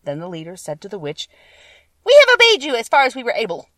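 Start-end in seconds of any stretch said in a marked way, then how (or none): 2.0-3.7 loud, high creature making a report; monkey leader's voice. A narrator's reading. Winged monkey leader reporting back to the witch, loud high declaration.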